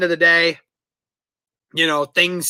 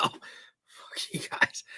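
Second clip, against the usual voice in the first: breathy whisper, slowed right down; whispered curse; 15 dB quieter, much slower than usual. Real, not acted. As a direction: hiss it under your breath. Friends urge shotgunning, whispered.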